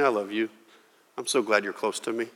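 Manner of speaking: hushed loving words; tender whisper. Pretend. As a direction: soothe the sheep gently. Shepherd's wordless message.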